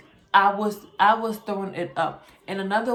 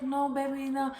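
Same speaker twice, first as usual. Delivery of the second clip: mom voice; 7 semitones higher than usual. Retold or imitated.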